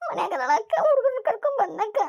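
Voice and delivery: sobbing while explaining; tearful, broken complaint; crying. Acted. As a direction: speak through tears. Girl sobbing tells woman.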